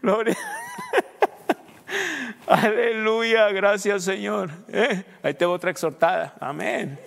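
loud, high praise shouting; rally the church with a cry of praise; loud pulpit praise and exhortation; preaching at full force. Acted.

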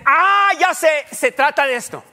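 high, loud, slow ah-ha of discovery; mock lightbulb moment; eureka moment. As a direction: stretch out the realization like a listener catching on. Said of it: "a ver si con esto ya me dicen".

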